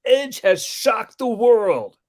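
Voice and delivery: high, loud, drawn-out big call; excited wrestling commentator. Retold or imitated. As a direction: imitate a commentator's big moment. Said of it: "I think it was Coach who said".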